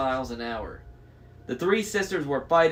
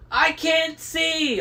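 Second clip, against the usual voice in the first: high, drawn-out wail; yelling; 14 semitones higher, 4 dB louder, much slower than usual. A narrator's reading. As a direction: cry out long and high, blinded. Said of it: "oh, yelled".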